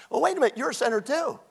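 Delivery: ordinary talk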